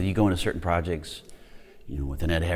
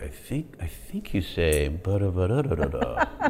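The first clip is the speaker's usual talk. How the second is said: tentative line prompting; 2 semitones higher than usual. Retold or imitated. Acts feeding co-star his line.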